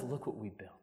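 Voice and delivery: low, hushed father to his son; quiet, confiding, acted fatherly tone; tender fatherly sharing. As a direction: voice a proud dad softly sharing the credit.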